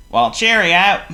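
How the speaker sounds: a departing guest waving farewell; calling goodbye. Acted. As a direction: call it out as you leave. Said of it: "called Mister Clumsy".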